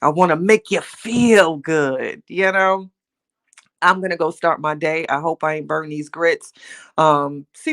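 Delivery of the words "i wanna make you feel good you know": tender, sincere. Real, not acted. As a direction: say it like a gentle promise. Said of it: "I just want you to feel good".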